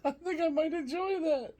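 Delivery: high squeal of laughing speech; real laughter. Real, not acted. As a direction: say it while cracking up. Laughing at imagined egg game.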